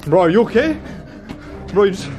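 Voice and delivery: usual speaking voice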